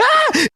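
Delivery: sudden high-pitched cry; high yelp. Pretend. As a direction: squeal it out suddenly. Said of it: "He gonna be like".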